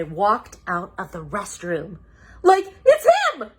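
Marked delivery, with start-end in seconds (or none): 2.4-3.5 high loud screech; Shaggy screeching. Acted. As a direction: point and shriek. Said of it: "Shaggy screeched".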